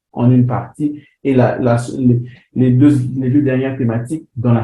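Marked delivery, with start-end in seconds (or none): none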